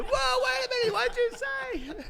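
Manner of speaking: very high, loud disbelief; astonished shout. Retold or imitated. Team reacts to translator saying "fixin'", astonished shout. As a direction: holler it, stretching the question out in amazement.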